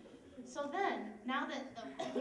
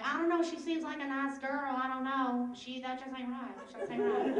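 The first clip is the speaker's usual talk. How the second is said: put-on Southern accent; 5 dB louder than usual. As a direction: imitate a puzzled Southern witness. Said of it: "I don't know why they had southern accents".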